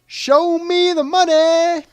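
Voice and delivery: high, loud, drawn-out movie yell; shouted catchphrase. Acted. Quiz player quoting the famous film phrase, high drawn-out shout.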